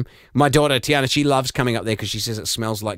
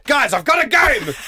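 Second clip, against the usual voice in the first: mock announcer; 12 semitones higher, 4 dB louder than usual. Announcing game mockingly.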